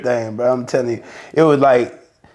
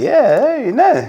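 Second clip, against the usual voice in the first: high-pitched breezy boast; retold bragging, much higher voice; retold cocky assurance; 12 semitones higher than usual. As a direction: brush it off as easy, pitched way up. Acts own cocky reply to crew.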